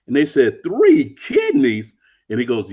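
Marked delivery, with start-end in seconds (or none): 0.6-1.9 high, loud, slow disbelief; astonished echo. Retold or imitated. Surgical assistants hearing of a third kidney, astonished. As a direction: drag it out in shocked disbelief.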